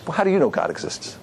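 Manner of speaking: a skeptic's challenge put to the rabbi; challenging. Acted. Lady challenging the rabbi to prove God, challenging. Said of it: "it would have sounded more like".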